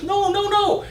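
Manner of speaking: high, pleading no no no; desperate king pleading. Acted. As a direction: beg her not to leave. King pleads as witch leaves.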